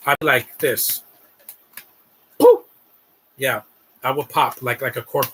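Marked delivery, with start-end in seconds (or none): 2.4-2.6 short loud poof; popping sound. Pretend. Streamer imitates himself popping like a cork, loud poof. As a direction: burst out with a pop.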